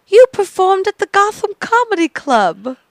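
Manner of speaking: put-on high, loud burst of realization in a light accent; sudden recognition. European woman suddenly placing the comic, excited imitation. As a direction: blurt it out as it clicks. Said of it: "she snaps after a few seconds".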